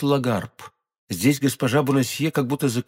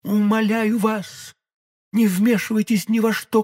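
much higher, imploring woman's voice; pleading young woman; 5 semitones higher than usual. Acted. Madame Bonacieux imploring d'Artagnan, high and pleading. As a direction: beg him earnestly to forget her.

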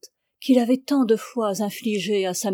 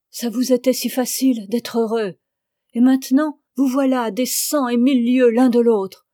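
put-on louder, impassioned old woman; loud pleading; 2 semitones higher, 6 dB louder than usual. Old Jacquine begging Augustin to let her fetch Fanny, loud and impassioned. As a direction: plead with full force.

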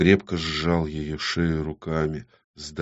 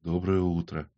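quiet morning greeting; 10 dB quieter than usual. A narrator's reading. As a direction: murmur a hushed greeting to a lover. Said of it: "тихо произнёс".

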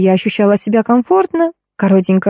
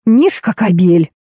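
hissed; slower than usual. A narrator's reading. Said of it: "зашипела она".

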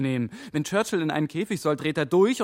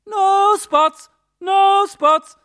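high squawking parrot voice, louder; parrot imitation; 13 semitones higher, 7 dB louder, much slower than usual. Detective imitating the parrot's recorded phrase, squawking. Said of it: "Hörst du's denn nicht?".

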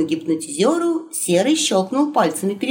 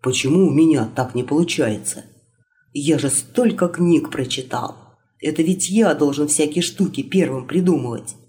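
put-on lower, musing young prince; low inner monologue; 5 semitones lower than usual. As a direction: think it through, lower voice.

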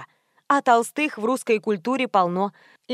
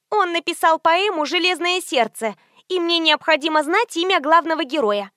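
higher-pitched socialite on the phone, impatient; pushy socialite friend; 7 semitones higher than usual. A narrator's reading. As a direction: voice a demanding girlfriend, pitched up.

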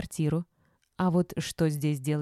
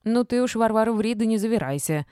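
higher, louder chiding; mild scolding of a neighbour, pitched up; reproving; 5 semitones higher, 5 dB louder than usual. A narrator's reading. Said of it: "сделала замечание та".

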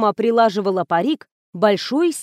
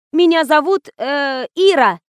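high, raised introduction with hesitation; 5 semitones higher, 4 dB louder than usual. A narrator's reading. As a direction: speak up for the hard of hearing. Calling to a deaf woman.

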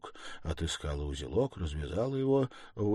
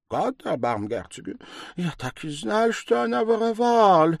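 rising, high-pitched outcry; German-accented indignation, building louder; exclaiming German landlady; 14 semitones higher, 10 dB louder than usual. A narrator's reading. Landlady claiming she knew of the theft, high exclaiming.

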